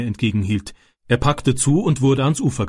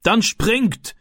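high, urgent call to jump; called-out command; 10 semitones higher than usual. A narrator's reading. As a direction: call it out sharply raised in pitch. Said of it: "rief der Mann".

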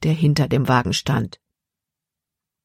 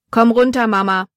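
louder, higher call into the wagon; 6 semitones higher, 3 dB louder than usual. A narrator's reading. Jordan calls his mother out of the wagon, loud call. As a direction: call her out from inside the wagon. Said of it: "rief Jordan in den Wagen".

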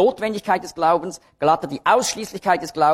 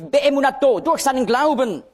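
"Beemunato" and "Glauben" pitched high and emphatic; accent on Beemunato; 8 semitones higher than usual. Shown as a demonstration. Preacher showing the Galatians accent, stress on "Beemunato". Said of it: "jedes Mal wird der Akzent auf ein anderes Wort gelegt".

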